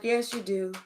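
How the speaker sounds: hushed accusing reply; quiet retort. Retold or imitated. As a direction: say it under your breath, knowingly. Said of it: "They're like".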